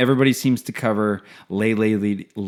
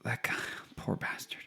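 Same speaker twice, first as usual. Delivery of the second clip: whisper; 4 semitones lower, 21 dB quieter than usual. Real, not acted. Pitying aside.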